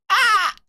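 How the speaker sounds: high loud yelp; cringing shriek. Real, not acted. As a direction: yelp from secondhand embarrassment. Overwhelmed by cringe.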